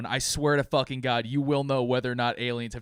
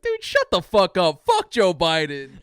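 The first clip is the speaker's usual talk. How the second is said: loud, slow mock rant; hammed-up angry rant, louder; retold outburst; 5 semitones higher, 9 dB louder, slower than usual. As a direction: bark it out like the annoyed voter he was. Retelling own angry reaction.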